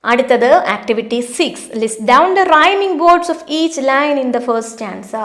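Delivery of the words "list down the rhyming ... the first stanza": reading the question out very slowly; slow, deliberate classroom read-aloud; slow textbook reading. Acted. Teacher reads activity instructions.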